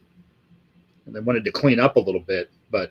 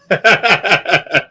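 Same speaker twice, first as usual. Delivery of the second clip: high rapid cackle; bursting high laugh; 8 semitones higher, 4 dB louder than usual. Real, not acted. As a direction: cackle at your own joke. Laughing at own bit.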